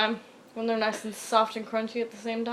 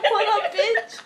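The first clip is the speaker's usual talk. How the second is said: high, loud laughing jeer; taunting gloat; 10 semitones higher, 5 dB louder, much slower than usual. Retold or imitated. Girl taunting tattled boy, high laughing jeer. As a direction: rub it in his face.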